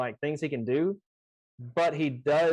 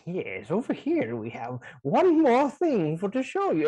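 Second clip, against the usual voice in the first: slow, higher put-on character voice; trying out a character voice; 5 semitones higher than usual. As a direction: ham it up like a tour guide showing off wares. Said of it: "trying my voices out".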